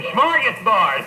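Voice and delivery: mock scream. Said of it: "he kept screaming".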